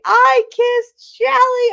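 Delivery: high, loud, drawn-out crowing; mock boastful announcing. Co-worker telling everybody about the kiss, gloating shout. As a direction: announce it slowly to the whole room, pitched high. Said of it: "runs inside and tells everybody".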